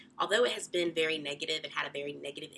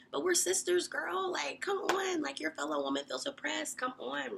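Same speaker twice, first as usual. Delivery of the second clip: high-pitched pleading with a friend; exasperated plea; 10 semitones higher than usual. Retold or imitated. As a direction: appeal to her sisterhood, pitched high. Retold exasperated plea to another woman.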